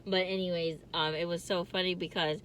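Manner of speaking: ordinary delivery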